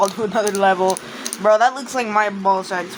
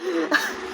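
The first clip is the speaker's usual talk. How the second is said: hiccup giggles; 5 semitones higher, 7 dB quieter than usual. Real, not acted. Laughing at the game creature.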